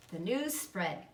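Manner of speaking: ordinary delivery, nothing marked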